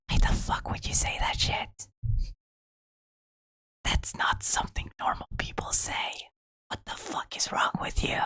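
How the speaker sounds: voicing her pet in a hushed, breathy scold; a scolding whisper from a pet; whispered role voice. Playing Whisper scolding him, in a whisper. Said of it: "She's like".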